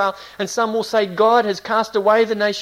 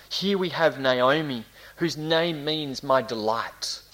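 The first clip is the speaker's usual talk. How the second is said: ordinary talk, nothing marked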